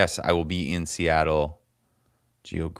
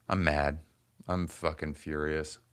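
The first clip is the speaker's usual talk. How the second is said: ordinary talk, nothing marked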